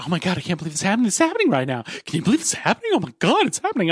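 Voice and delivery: fast, high, breathless squealing over the movie; mock fangirl gushing. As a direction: gush like a starstruck fan, rushing. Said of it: "When the Spider-Man show".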